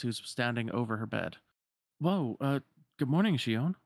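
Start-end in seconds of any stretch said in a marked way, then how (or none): 2.0-3.8 put-on lightly higher boyish voice, a touch startled; a boy caught off guard at her bedside; startled Roxas. Roxas greeting Shion as she wakes, mildly startled.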